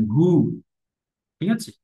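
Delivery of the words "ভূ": ভূ with the u drawn out; long vowel. Shown as a demonstration. Teacher contrasting short and long u, drawn-out ভূ. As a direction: hold the vowel of ভূ. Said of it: "দীর্ঘ ইকার হয়ে যায়".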